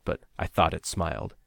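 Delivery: plain reading voice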